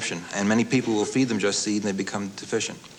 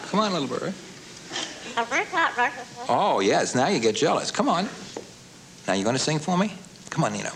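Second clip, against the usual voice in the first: high sing-song coaxing; coaxing a wild bird; 9 semitones higher than usual. Real, not acted. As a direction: sweeten it up to coax the bird.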